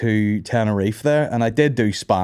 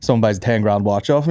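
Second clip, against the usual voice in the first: normal talking voice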